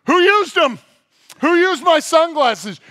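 high, loud shrill-free mock mom voice; mom demanding. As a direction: play the furious mom hunting sunglasses. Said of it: "she's like".

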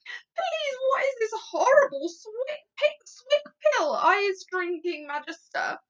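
high, loud protest at a foul drink; appalled cry. A narrator's reading. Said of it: "he cried".

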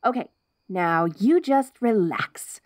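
ordinary talk

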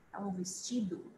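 whispered gloss É um vestido. Real, not acted. Teacher whispers the meaning to students. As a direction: breathe the translation softly.

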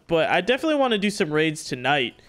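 usual speaking voice

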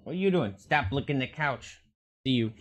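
ordinary delivery

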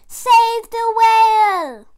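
slow, high, loud proclamation; rallying cry. Acted. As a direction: call the village to action. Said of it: "a slithering trail saying".

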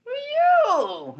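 very high, shrieking curse at the ghost; furious high-pitched yelling; cursing her out. Retold or imitated. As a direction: scream the insult at the bride. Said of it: "I, I cursed her out".

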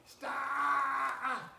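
sharp high yell to make the goat stop; shout at goat. Real, not acted. Scolding a goat in the barn.

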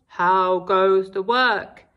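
low, slow farmer call; lazy farmer calling. Acted. Lazy farmer calling from bed to the duck. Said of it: "called the farmer".

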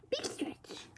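breathy hush, like soothing a newborn; whispering. Real, not acted. Whispering to a waking baby.

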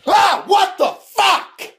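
high, slow shocked yell; startled scream. Acted. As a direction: jump back screaming. Startled by the kitty.

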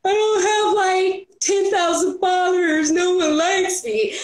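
high, slow pitiful moan about followers; hammed-up sulky lament; mock self-pity whine. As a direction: whine like nobody loves you. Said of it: "but I'm like".

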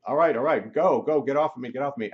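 loud, high, hurried commands; frantic retold yelling. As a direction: shout it off you, re-enacting the struggle. Acts himself ordering spirit off.

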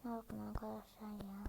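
soft whispered aside. Real, not acted. Whispering to her viewers to look at the photo. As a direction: point it out under your breath.

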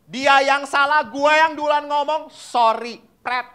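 high, loud indignant protest; proud refusal. Retold or imitated. Proud spouse refuses to apologise first, high indignant scoff.

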